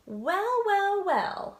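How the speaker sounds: mildly gloating storybook voice; smug tortoise. Acted. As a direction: savor it like you've won. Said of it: "said the tortoise".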